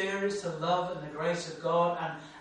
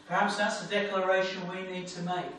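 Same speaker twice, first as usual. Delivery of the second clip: usual speaking voice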